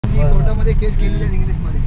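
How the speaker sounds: loud and fast, boasting about the court case. Real, not acted. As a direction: shout it out proudly, rapid-fire. Farmer loudly boasting about court.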